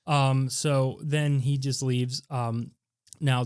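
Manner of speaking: normal talking voice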